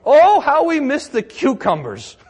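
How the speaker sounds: loud, high whining lament; mock complaining. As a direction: ham up the grumbling Israelites pining for Egypt. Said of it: "remember what they complained".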